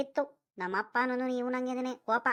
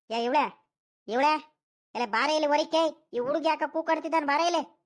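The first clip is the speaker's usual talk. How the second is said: raised, louder call across the house; calling out to wife; 4 semitones higher, 4 dB louder than usual. Acted. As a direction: summon your wife from another room, higher and louder. Grandfather calls his wife out. (ಹೆಂಡತಿಯನ್ನು ಜೋರಾಗಿ ಕೂಗಿ ಕರೆಯುವುದು)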